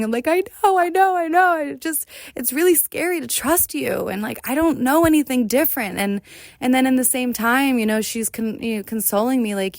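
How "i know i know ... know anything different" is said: much higher, tearful pleading voice; retold crying. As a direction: replay the sobbing plea, voice breaking up high. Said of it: "And I'm just crying".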